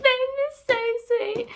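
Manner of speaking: very high, tearful-sounding gush; emotional squealing. Real, not acted. Reader overcome by Bane's birthday gesture, tearful squeal. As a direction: squeal it as if melting.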